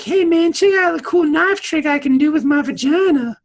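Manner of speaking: put-on high, loud, cocky young woman; high, loud teen bragging; bratty daughter. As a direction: boast like a show-off kid. Dukat's daughter voiced.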